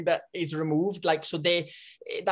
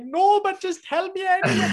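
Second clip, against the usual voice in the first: eager retold reply; 13 semitones higher, 5 dB louder than usual. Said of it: "And I was like".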